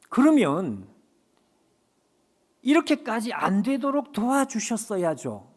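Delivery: half-hearted grumbling at God; mock reproach. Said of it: "이렇게 말했습니다". (원망하듯 살짝 높게 따지며)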